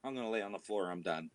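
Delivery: low, soft, fast worn-out groan; tired dog voice. Retold or imitated. The owner voicing his tired dog after a run, low and soft. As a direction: flop down exhausted as the dog. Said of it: "is he totally like".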